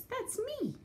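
very high squeaky virus. Acted. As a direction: squeak it like a tiny germ. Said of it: "the virus says".

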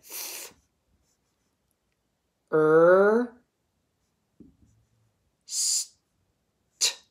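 "Th-" whispered, "er" voiced, "st" whispered, long gaps; tapping sounds. Shown as a demonstration. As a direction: tap out "Th-", "er", "st" one sound at a time. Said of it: "Then I tap it".

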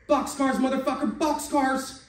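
yelling boxcars at the shooter; gleeful yell. Acted. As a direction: crow over the other guy's loss. Player cheers the losing roll.

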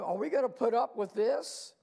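mock indignant question. Acted complaint of indignant church.

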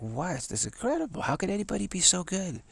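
retold astonishment. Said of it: "I was like".

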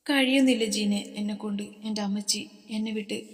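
ordinary delivery

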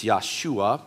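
Yahshua said slowly as Yah-shu-a; Hebrew pronunciation. Shown as a demonstration. Pastor teaching the Hebrew name, Yahshua said slowly. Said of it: "In Hebrew, it's".

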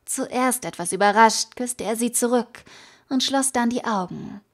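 plain reading voice

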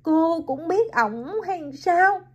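surprised. Acted. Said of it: "Hiền ngạc nhiên".

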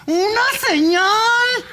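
high, slow shouted summons; shouted plea. Acted. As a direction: cry out for a sign. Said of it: "denos una señal".

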